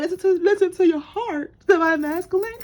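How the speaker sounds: high, sappy mock plea; mock emotional. Reader teasing the divine masculine, high and mock-emotional. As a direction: ham up a tender plea. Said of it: "we getting all emotional here".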